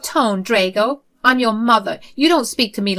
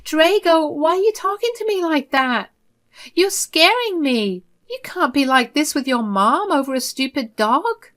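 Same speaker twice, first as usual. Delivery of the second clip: much higher, pleading tearful voice; softly crying mother; 9 semitones higher, 4 dB louder than usual. Acted. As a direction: play the victim to soften him. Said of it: "placate him by softly crying".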